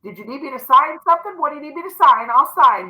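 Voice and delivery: playful hands-off eagerness; breezy handover. Acted. Acts handing the problem to God.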